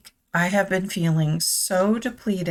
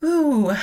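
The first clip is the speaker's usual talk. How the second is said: high, loud emotional "ooh"; emotional exhale; 8 semitones higher, 5 dB louder than usual. Real, not acted. Emotional ooh while composing herself for viewers. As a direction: let the feeling out in one breath.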